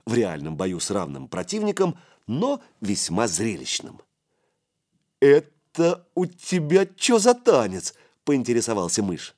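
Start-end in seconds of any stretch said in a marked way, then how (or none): 5.2-7.9 put-on curious young boy. A narrator's reading. Curious boy asks about kick.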